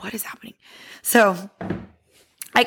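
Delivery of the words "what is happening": very soft, near-whispered bewilderment; whispered aside. Retold or imitated. Baffled woman to herself after the screaming, whispered. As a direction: whisper it as if to yourself.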